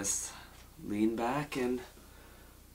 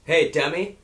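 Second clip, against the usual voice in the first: louder, higher insulting greeting; mock rude shout; 4 semitones higher, 8 dB louder than usual. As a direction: bark the insults at the caller. Insulting voicemail take, shouted.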